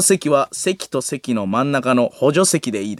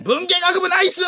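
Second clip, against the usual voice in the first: excited cheer. Retold or imitated. Hyped MC cheering a faculty's ratio, high excited yell. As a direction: cheer the department like a hype man. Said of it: "もう俺もめっちゃ興奮して".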